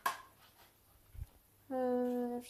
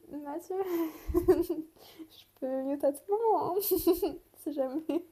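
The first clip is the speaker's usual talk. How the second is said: laughing; 4 semitones higher, 7 dB louder, much slower than usual. Real, not acted. Laughing plea to brother.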